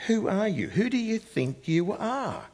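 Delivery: high, scornful jab at a bold claim; mocking challenge, pitched high and slowing on the second question. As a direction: throw it out as a sneering challenge. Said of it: "a little bit of a jab".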